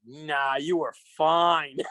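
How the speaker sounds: low, slow, soothing reassurance; calm him down gently; low reassuring. Retold or imitated. Friend soothing Dave after his blowup, low and slow.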